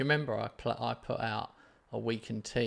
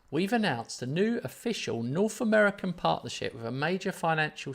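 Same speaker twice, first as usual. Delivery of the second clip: dramatic announcement; 5 semitones higher, 6 dB louder than usual. Acted. Streamer revealing a sponsorship deal to friend, dramatic announcement. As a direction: read it out like a big reveal.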